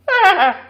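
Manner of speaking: high, loud scornful laugh; mocking cat laugh. A narrator's reading. Bungle laughing at the man, high and loud. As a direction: laugh in his face. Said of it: "exclaimed Bungle".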